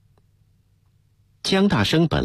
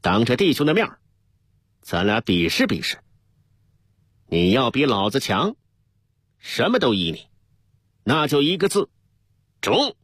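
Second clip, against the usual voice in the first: raised pitch without much volume; mild yelled challenge; 5 semitones higher than usual. A narrator's reading. Deputy draws his pistol and challenges the captain, mild yell. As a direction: throw down a challenge, pitch climbing to the last word. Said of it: "对着江大生嚷".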